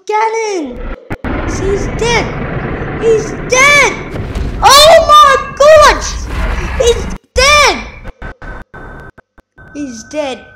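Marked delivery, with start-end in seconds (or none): 0.1-7.8 high, loud yells of disbelief; real screaming excitement, words stretched out; ecstatic shouting. Real, not acted. Player has killed Ganon, ecstatic shouting. Celebrating the boss kill.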